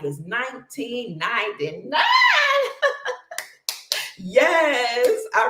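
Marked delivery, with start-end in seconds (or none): 2.1-3.4 high, loud burst of laughter. Real, not acted.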